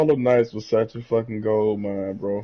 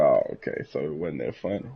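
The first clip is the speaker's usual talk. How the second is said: lower, deflated mock quote; imitating a deflated friend; 9 semitones lower, 6 dB quieter than usual. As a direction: let the air out like the joke bombed, lower. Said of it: "he's like".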